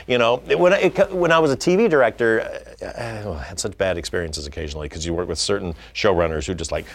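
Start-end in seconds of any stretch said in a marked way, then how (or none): none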